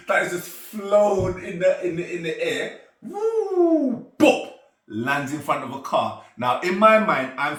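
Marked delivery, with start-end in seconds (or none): 3.0-4.1 high, loud sustained "woo"; imitated whoosh. Imitates ring flying through air. 4.2-4.5 short, loud "bop"; imitated landing sound.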